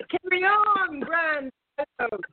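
high, posh-sounding British put-on; mock British accent. Cohost redoes the line in a hammed-up British accent. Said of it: "you said it with such a great accent".

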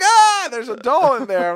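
high, loud, slow startled cry; mock fright. Hungover man finding the doll, mock startled cry. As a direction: act out spotting the doll. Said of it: "I'm like".

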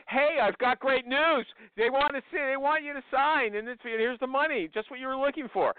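hammed-up excited recruiter; pepped-up. Shown as a demonstration. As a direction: sell the news with forced cheer. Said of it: "pep yourself up and say".

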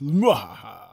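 evil cackle, mwahaha; mad scientist laugh. Pretend. Mad scientist laugh after name.

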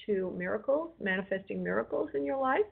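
ordinary delivery

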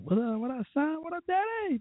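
high put-on voice calling out; imitating how people greet him, pitched high; mock street greeting. Mimicking people who greet him, high and put-on. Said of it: "A lot of people call me".

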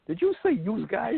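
mock disbelief. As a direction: mimic a kid catching out a parent. Kid catches the dialect slip.

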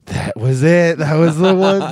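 loud certain confession; sister confirming, emphatic. Retold or imitated. Sister naming the epicenter of her veganism, emphatic. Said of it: "And she's like".

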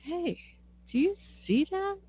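high, urgent call to look; excited call. Retold or imitated. Retold calling out to friend.

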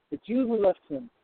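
normal talking voice

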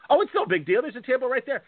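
high-pitched mocking brush-off; dismissive Virgil imitation. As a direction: put on a high, careless voice. Imitates Virgil dismissing the table dispute.

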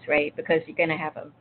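ordinary delivery

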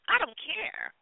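usual speaking voice

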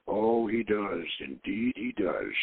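slow, low and knowing. A narrator's reading. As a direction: let each word sink in with quiet irony. Sophie's knowing look.

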